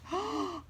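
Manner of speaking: quick high gasp; excited gasp. Real, not acted. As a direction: gasp in delight. Bag revealed.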